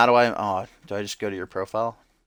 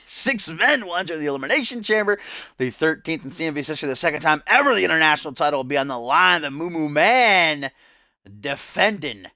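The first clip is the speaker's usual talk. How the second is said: raised, driving pay-per-view hype voice; big, high-pitched promo read; wrestling announcer; 8 semitones higher, 4 dB louder than usual. Acted. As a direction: build up the title match like a ringside commentator. Wrestling match announcer commentary.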